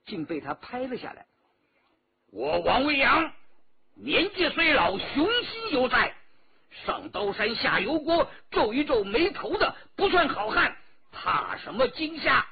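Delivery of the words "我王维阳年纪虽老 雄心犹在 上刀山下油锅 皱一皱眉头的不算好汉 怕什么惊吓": put-on proud old hero boasting. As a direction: bristle with pride, voice lifted. Old escort boasting, stung by age.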